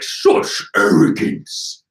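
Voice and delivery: slow, rasped monster voice; scornful villain. Acted. Villain scorning the arrogance, slow rasping voice. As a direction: rasp it out with drawn-out disdain. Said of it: "Orochi's like".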